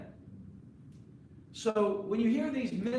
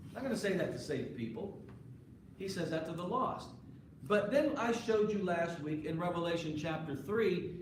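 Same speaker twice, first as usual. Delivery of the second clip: ordinary talk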